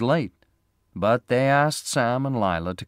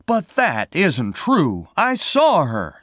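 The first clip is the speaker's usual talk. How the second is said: slow, loud, high protest; cried out; 9 semitones higher, 9 dB louder, slower than usual. Acted. Sam disputing the sheriff, slow loud high protest. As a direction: insist loudly, voice climbing. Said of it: "Sam cried".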